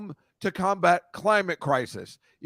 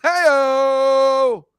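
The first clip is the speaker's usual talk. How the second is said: loud, high cheery call-out; hey-o call; 9 semitones higher, 7 dB louder than usual. Real, not acted. Host greeting everyone, loud cheery hey-o. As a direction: sing it out like greeting a crowd.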